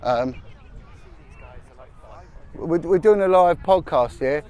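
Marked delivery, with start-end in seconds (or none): none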